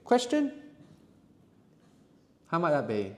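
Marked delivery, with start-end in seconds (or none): 0.0-0.5 rising question hint. Shown as a demonstration.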